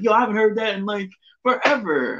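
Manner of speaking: lightly voiced buddy, pitched well up; a high-pitched imitation of a pal reacting; imitating a friend. Buddy reacting to an old song, high-pitched imitation. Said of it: "one of my boys on, uh, Wrestle Talk was like".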